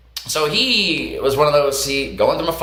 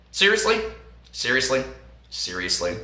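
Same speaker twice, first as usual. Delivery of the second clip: fading; 4 semitones lower, 7 dB quieter than usual. Retold or imitated. Repeated exasperated question fading.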